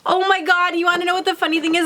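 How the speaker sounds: high, gasping rush of delight; breathlessly thrilled; overwhelmed excitement. Real, not acted. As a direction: start high and race into the question. Overwhelmed by the gift.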